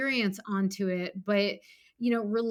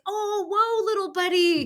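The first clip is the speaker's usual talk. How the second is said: put-on high, louder coaxing call to a toddler; gentle parent voice; 11 semitones higher, 6 dB louder than usual. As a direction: talk her inner child down like a small kid. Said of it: "toddler child".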